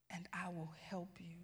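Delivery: near-whispered divine promise; God's quiet voice. Retold or imitated. God promising help to the father, whispered gently. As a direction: drop to a low whisper, as God speaking. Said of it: "hearing God very clearly say this".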